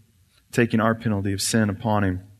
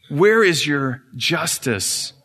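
slow demand; 3 semitones higher, much slower than usual. Acted. Satan demanding justice.

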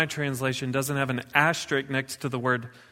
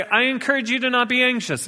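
higher, quicker, mock gentle suggestion; mock soft suggestion; 9 semitones higher, faster than usual. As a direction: say it like a polite suggestion you don't mean. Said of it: "It doesn't say".

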